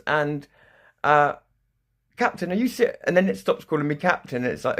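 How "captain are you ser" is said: incredulous computer. Retold or imitated.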